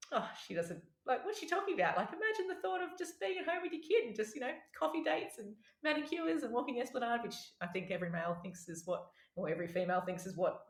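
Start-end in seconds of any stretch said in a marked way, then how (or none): 0.1-7.2 high-pitched, dismissive inner voice; naive disbelief. Retold or imitated. New mother privately dismissing her colleague's warning, in naive disbelief. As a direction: act out breezy disbelief, pitched way up.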